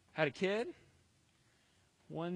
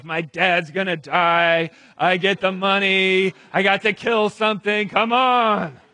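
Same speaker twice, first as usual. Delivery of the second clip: loud, high, hammed-up elation; slow, whooping boast building to the end; gleeful crowing; 4 semitones higher, 15 dB louder, slower than usual. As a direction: shout it like your best day ever. Esau elated, voiced by preacher.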